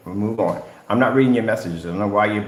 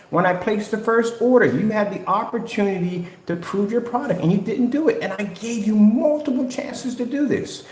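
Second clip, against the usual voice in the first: high-pitched, worked-up real rant; exasperated scolding; 9 semitones higher than usual. Real, not acted. Calling out Danny over missed chances, exasperated. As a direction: scold at a raised, insistent pitch.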